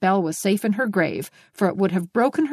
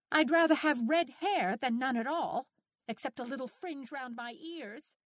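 higher, softer mild retort; flashing retort; 6 semitones higher, 10 dB quieter than usual. A narrator's reading. Anne retorts to the bald neighbour, a mild flash. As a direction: fire it back, stung. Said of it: "she flashed".

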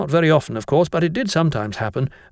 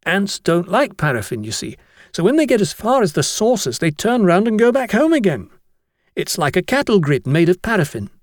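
put-on higher boyish voice, keen to explain; know-it-all schoolboy; 7 semitones higher than usual. Atkinson explains his paraffin trick to the master, keen boyish voice. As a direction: show off your clever idea.

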